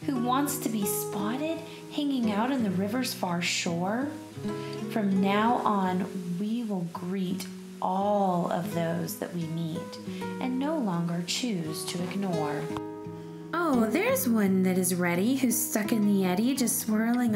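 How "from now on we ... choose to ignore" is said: plain reading voice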